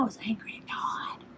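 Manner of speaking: unvoiced whisper of the admission; whispered confession. Real, not acted. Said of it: "I was so angry".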